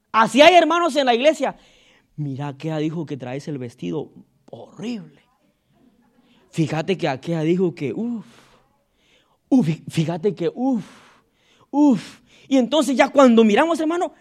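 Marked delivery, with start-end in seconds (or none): 2.2-12.2 low, soft gossiping sister; gossip whisper. Retold or imitated. Pastor acting a gossiping sister, low and soft. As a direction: breathe out the rumour like a secret.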